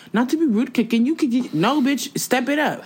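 high-pitched mock quote turning to comeback; mocking retort. Host mocking a polite-rude online replier, mocking. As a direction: mimic them high, then fire back. Mocks replier, then snaps back.

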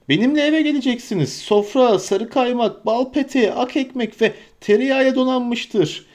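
high, merry invitation; jolly Tom Bombadil. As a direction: welcome them home heartily, pitched high. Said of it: "kahkahalarla gülmeye başladı".

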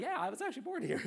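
high-pitched put-on voice; comic high voice for a quoted reply; native local's reply. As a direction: pipe up high as a proud local.

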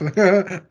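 short awkward nervous laugh. Real, not acted. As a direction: laugh it off, uneasy. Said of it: "it's that nervous laugh".

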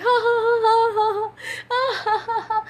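high-pitched "ha ha ha" laughter, acted out; re-enacted laughing. Re-enacting laughing in service, high helpless laughter. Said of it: "And just, I'm laughing".

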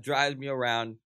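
ordinary delivery

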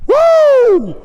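loud, high "woo"; sustained high holler of praise; exultant whoop. Real, not acted. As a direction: let out a long preacher's whoop. Preacher whoops after his testimony.

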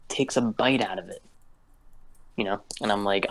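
ordinary delivery

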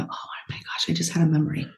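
soft whispered wonder; hushed surprised aside; imitating a hushed realization. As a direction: whisper it as a memory surfaces. Imitated sudden memory.

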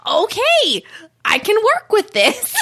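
high-pitched, gleeful approval; delighted reaction. Retold or imitated. Viewer reacting to him on stage, delighted. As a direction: break into a high, laughing exclamation.

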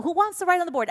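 high, bright mock teacher call. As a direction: lightly play the cheery teacher asking the class. Teacher voiced in story.

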